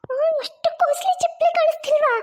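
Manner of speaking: high, shocked whisper; stunned whisper; shocked mother. Acted. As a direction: gasp it in disbelief.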